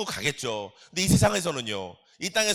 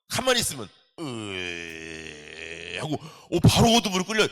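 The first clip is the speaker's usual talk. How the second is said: mock groan of dread; 7 semitones lower, slower than usual. Acting being dragged into darkness.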